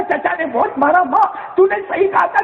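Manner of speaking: put-on a beaten boy crying to his mother; sobbing son.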